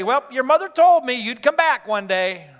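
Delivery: loud, high I-told-you-so; scolding parent's taunt; harsh father. Acted. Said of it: "that seemed a little harsh".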